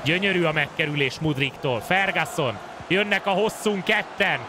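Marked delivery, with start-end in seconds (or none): 2.9-4.4 excited Hungarian sports commentary. Acted. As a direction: build up and bellow the name. Attack builds to cross.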